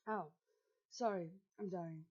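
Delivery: choking cough; coughing after water went down wrong; swallowed wrong way. Real, not acted. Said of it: "choking on water".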